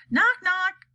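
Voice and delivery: high, loud knock-knock voice; joking president. Acted. President tells the spy a joke, high and loud. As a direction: start the joke brightly. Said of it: "said the president".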